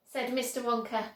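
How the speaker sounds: plain reading voice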